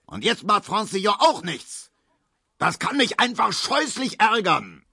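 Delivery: hoch und erregt, „natürlich“ zügig; wütend. A narrator's reading. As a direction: schimpft sich den Ärger von der Seele. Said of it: "brüllte Herr Markowski". (Markowski schimpft hoch und erregt über verlorenes Geld)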